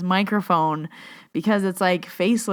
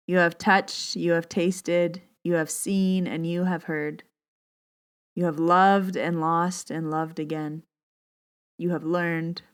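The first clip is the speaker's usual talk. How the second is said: unhurried, soothing reading voice; guided meditation. Acted. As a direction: guide listeners gently into their breath.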